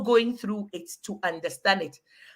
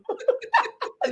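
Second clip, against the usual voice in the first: high breathy squeal of laughter; 14 semitones higher than usual. Real, not acted. Laughing at friend's joke.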